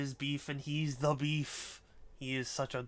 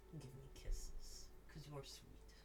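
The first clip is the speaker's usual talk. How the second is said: slow whispered coo to the cat; soft slow pet whisper; 10 dB quieter, slower than usual. Real, not acted. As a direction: breathe it softly to the kitten. Asking cat for kisses.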